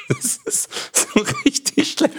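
talking through real laughter; helpless laugh breaking up the words. Real, not acted. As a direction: crack up at your own bad joke. Cracks up at own bad joke.

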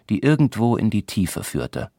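usual speaking voice

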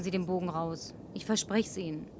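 whispered promise, voice dropped away. Acted. Woman whispers a promise to the struggling driver. Reassuring him about driving.

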